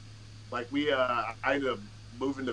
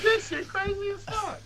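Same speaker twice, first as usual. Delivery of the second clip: high amazed voice. Retold or imitated. Acting out his own amazement.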